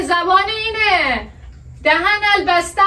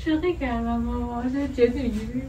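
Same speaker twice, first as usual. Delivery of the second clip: quiet admission; 8 semitones lower, 9 dB quieter than usual. Real, not acted. Wife admits the prank.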